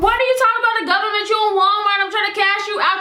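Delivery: high, rapid-fire exasperation; exasperated outburst. Retold or imitated. Acted exasperated outburst at customer.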